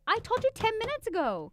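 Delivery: high, exasperated scolding; exasperated mom. Retold or imitated. Mom scolding daughter, retold.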